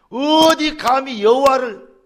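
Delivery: slow, loud, high and outraged; David scorning the giant's insult; indignant outcry. Acted. Preacher voicing David, slow loud outrage.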